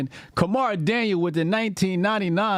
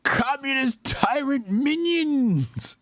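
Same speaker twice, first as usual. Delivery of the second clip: slow, higher, mocking parrot; mock repeat; 7 semitones higher, slower than usual. Reactor parroting the streamer's rant, mocking. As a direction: drag out the phrase to ridicule it.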